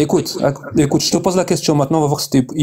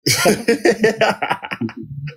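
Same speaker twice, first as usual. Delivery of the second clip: laughing; 6 semitones higher, 4 dB quieter than usual. Real, not acted. Laughs at takfir threat.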